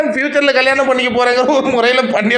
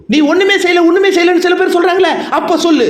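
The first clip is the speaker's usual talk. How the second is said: challenging quote; 6 semitones higher than usual. Retold or imitated. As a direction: push back hard, a relative's dare. Family member challenging the contestant.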